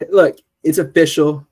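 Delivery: normal talking voice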